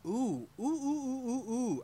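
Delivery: high excited cooing oohs. Real, not acted. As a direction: squeal ooh with delight. Excited by tattoo idea.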